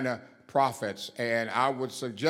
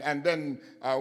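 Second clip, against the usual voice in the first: normal talking voice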